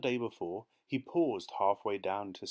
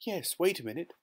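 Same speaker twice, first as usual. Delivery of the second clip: high, hurried reply; flustered urgency; 9 semitones higher than usual. A narrator's reading. Wife hunting madly for the key, flustered. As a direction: stall him while searching.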